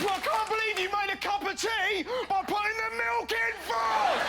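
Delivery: shouting high in mock horror; mock outraged shout. Comedian screaming at a tea-maker, hammed-up outrage. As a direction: bellow it like milk-first is a crime. Comic outburst of English anger.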